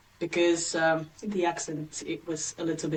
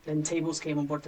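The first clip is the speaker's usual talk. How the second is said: usual speaking voice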